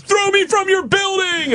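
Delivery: high shouted call to the crowd; rally them, yelling up high; crowd-pumping shout; hyping the crowd. Retold or imitated. Crowd hype on the rooftop.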